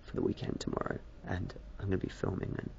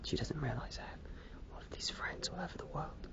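very soft, higher, nearly whispered; hushed confiding; 8 semitones higher, 11 dB quieter than usual. Real, not acted. Vlogger confiding about friends all over the world, hushed. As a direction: let it thin out into a high whisper. Confides what his wife doesn't realise.

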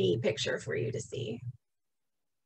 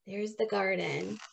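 plain reading voice

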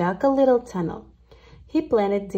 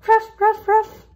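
high, louder yappy bark; dog bark; 13 semitones higher, 6 dB louder than usual. Acted. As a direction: bark it out like a little dog, much higher. Dog barks in story.

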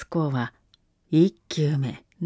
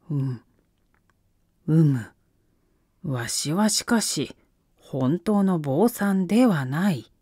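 put-on creaky wise old man; 2 semitones lower than usual. Shogun cornered, hesitating.

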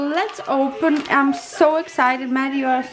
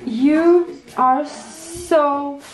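normal talking voice

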